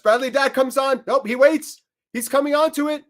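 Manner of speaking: high and loud, rising with the run-up; holding breath for the penalty, high and urgent; tense build-up. Real, not acted. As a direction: call the run-up on the edge of your seat.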